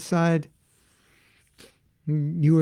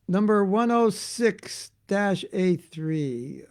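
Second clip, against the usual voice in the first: slow announcement; 4 semitones higher, much slower than usual. Retold or imitated.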